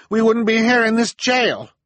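high, loud, sharp snap back; angry snap. Acted. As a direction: snap at him furiously. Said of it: "Violet snapped in reply".

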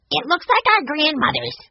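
put-on very high, eager child voice; blurted out. A narrator's reading. Boy cutting in on his sister, blurting. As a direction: burst in with a piping boy's blurt. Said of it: "Benny blurted out".

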